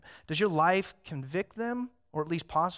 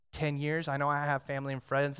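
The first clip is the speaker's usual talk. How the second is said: ordinary talk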